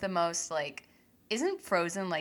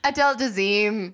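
mock announcement; 8 dB louder than usual. Echoing the botched name.

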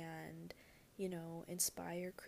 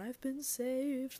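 louder, higher, slow ecstatic cry; mock born-again convert; 6 semitones higher, 6 dB louder, much slower than usual. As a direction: ham up a fervent convert overcome on the floor. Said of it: "fall to your knees and convulse on the ground".

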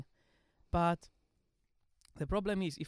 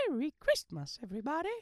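put-on high excited child's voice; little kid voice; 8 semitones higher than usual. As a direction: chirp it like Tiny Tim. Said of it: "wish us Merry Christmas like the little kid does".

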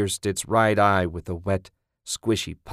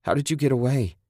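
higher-pitched, distraught wail; tearful wail; 5 semitones higher than usual. Acted. Woman to the man she thought dead, wailing. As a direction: wail it in anguished relief. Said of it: "she wailed".

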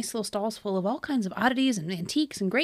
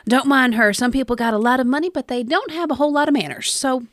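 put-on loud, folksy Southern accent; 6 dB louder than usual. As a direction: chat up a stranger in Southern twang. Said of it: "Your accent brought out my Southern accent".

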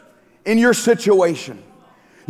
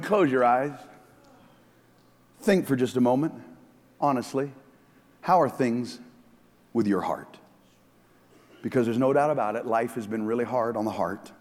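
quiet reflection; 11 semitones lower, 9 dB quieter than usual. Real, not acted. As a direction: guide the church gently inward.

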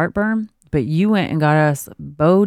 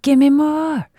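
put-on high, pleading baby voice; 10 semitones higher, slower than usual. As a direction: voice the baby begging for more. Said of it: "maybe she's like".

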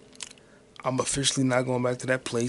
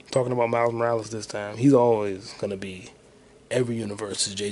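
ordinary talk, nothing marked

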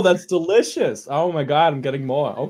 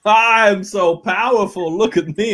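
higher, louder, slow gloating; boastful brute; 6 semitones higher, 5 dB louder than usual. Acted. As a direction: crow over your own might, slowly. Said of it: "I'm gonna hunch over and be like".

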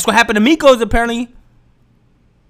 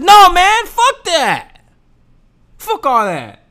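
higher and louder, cutting them off; loud dismissal; 10 semitones higher, 5 dB louder, much slower than usual. Acted. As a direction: shout down the objection. Shouting down imagined critic.

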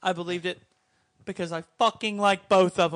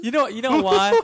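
laughing through words; 7 semitones higher, 7 dB louder than usual. Real, not acted. Still laughing.

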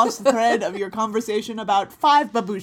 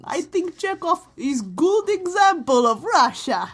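put-on Russian accent; 8 semitones higher, 3 dB louder, slower than usual. Mocking Chekhov's Russian speech.

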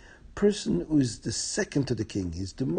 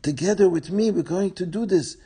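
higher, insistent viceroy; insistent pressure; 6 semitones higher, 5 dB louder than usual. Acted. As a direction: press her hard, voice lifting.

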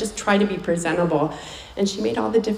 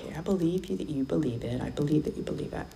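skeptical whisper; 4 semitones lower, 6 dB quieter, faster than usual. Retold or imitated. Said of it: "It's like".

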